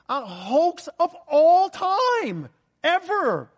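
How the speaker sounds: high, loud, driving preacher climax; preaching at full force. Acted. Pastor to his church, fervent full-force preaching. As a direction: hammer it home to the congregation, rising. Preacher builds to liars, hoax.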